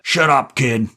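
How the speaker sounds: loud, angry shout. A narrator's reading. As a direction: bark it at the kid. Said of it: "Jerry shouted".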